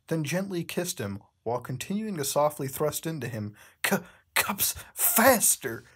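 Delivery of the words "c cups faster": high, whispered mock pant; panting Bendy. Reader voicing Bendy begging Cuphead, high whispered pant. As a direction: breathe it out high and hushed. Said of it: "Bendy panted out".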